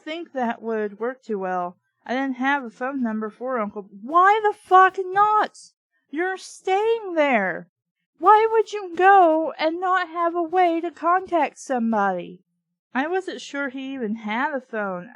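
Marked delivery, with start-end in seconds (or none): 4.0-12.4 much higher and louder, slow incredulous yell; exasperated shout. Real, not acted. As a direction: yell at the book in disbelief. Yelling at the narrator.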